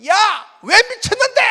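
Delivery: loud, high indignant yell; angry retort. Retold or imitated. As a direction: fire the question back furiously.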